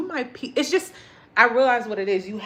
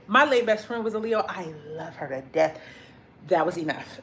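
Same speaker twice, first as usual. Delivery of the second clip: usual speaking voice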